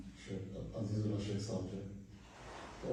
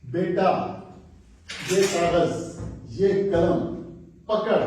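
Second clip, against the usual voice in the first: calling out; 6 semitones higher, 9 dB louder, much slower than usual. Acted. Umar calls son with order.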